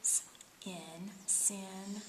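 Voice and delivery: "S-, in, sin" split first, then blended; sound it out. Shown as a demonstration. Teacher sounding out a syllable for a student, split then blended. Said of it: "let's try what you've got".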